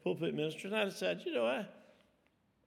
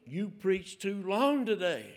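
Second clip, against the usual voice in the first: slow, loud, higher-pitched telling-off; upset scolding; 5 semitones higher, 5 dB louder, slower than usual. Retold or imitated. Elder's wife confronts preacher after long sermon, mock upset. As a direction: drag out the complaint, raised and indignant, mimicking her. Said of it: "She was really upset".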